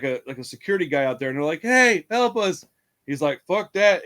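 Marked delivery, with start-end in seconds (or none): 1.6-2.6 loud, high, slow mock plea; hammed-up cry for help; calling for help. Girls calling to the security guard, mock loud plea. 3.5-4.0 loud, high mock brush-off; curt refusal. Security guard refusing the girls, loud mock brush-off.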